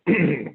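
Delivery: a quick real throat clear. Real, not acted. Panelist clearing a tired throat mid-answer, real throat clear. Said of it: "excuse me, losing my voice".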